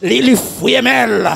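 shouted, driving sermon cadence; fiery preacher in full flow; full-force preaching. Acted. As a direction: preach it hard to the congregation. Preaching the fight, chanting saisi.

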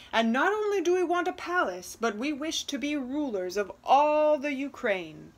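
loud, fast, high demand; greedy order. Acted. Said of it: "they ordered".

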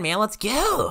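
high-pitched impatient call; impatient urging. Real, not acted. Player to friend still building, impatient urging. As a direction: hurry your friend along, pitch rising.

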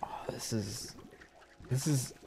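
hushed, breathless whisper. Real, not acted. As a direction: whisper it in disbelief. Whisper while shocked by game.

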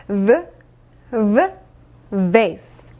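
"V, V, vase" low, with strongly buzzing v; vibrating v. Shown as a demonstration. Teacher stressing vibration, buzzing v in "V, V, vase". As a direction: make each v vibrate, pauses between, then "vase". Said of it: "진동이 막 울려져야 됩니다".

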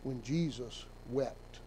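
soft, low and slow for emphasis; hushed emphasis. Real, not acted. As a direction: drop to a near hush on "Jesus wept.". Preacher drives the verse home.

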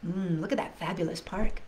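usual speaking voice

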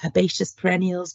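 normal talking voice

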